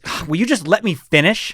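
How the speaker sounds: mock exasperated protest, a little laughing; exasperated child. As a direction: play an interrupted child losing patience. Son snaps at mother in story.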